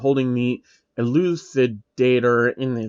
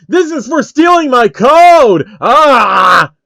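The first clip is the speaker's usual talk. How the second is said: loud, high charging shout; vengeful war cry; battle scream; 10 semitones higher, 10 dB louder, slower than usual. Acted. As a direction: scream as you attack. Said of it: "Phil screams".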